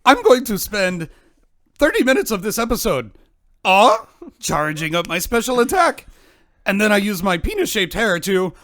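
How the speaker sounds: put-on hammy Japanese accent, high and loud; goofy anime villain in Japanese accent. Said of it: "you sound like a Japanese Tim Allen".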